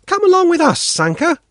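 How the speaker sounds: put-on coaxing young girl; friendly girl urging a boy along; girl's voice. A narrator's reading. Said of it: "she says".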